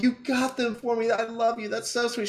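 squealing high delight; high giddy voice. Retold or imitated. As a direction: gush it way up high. Gushing thanks to wife for drinks.

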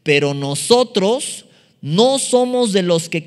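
loud, slow unison reading of scripture; read aloud together. Shown as a demonstration. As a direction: lead the congregation, reading out loud slowly. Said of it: "Lean esto junto conmigo en voz alta".

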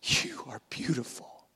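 Jesus whispering to the broken; tender whisper. Acted. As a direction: breathe it to her gently. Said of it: "he sees us in our ashen state and goes".

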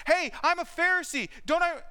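begging. Retold or imitated. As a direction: plead with the crowd, asking to be spared. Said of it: "Now he's begging".

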